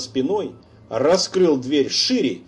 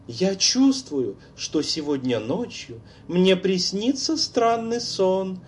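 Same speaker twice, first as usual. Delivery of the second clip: put-on eerie girl's voice; 6 semitones higher than usual. A narrator's reading. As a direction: play a dead girl, teasing. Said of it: "такой знакомый голос".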